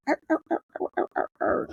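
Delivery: high, yapping little-dog barks; imitated small-dog yaps; yappy dog barks. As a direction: bark like a tiny annoying dog. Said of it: "So the dog be like".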